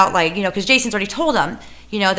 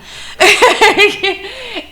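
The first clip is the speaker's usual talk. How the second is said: high laugh; 12 semitones higher, 6 dB louder than usual. Real, not acted. Laughing at own story.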